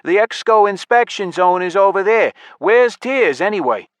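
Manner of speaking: high, loud elf officer; loud challenge. A narrator's reading. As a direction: call out directions and demand an answer. Elf challenges player in busy hall.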